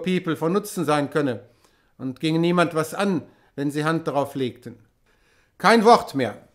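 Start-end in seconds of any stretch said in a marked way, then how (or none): 5.6-6.5 a sharp, loud stop to the chatter; loud interruption. A narrator's reading. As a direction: cut them off loudly. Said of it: "unterbrach sie Gregorius". (lautes Unterbrechen)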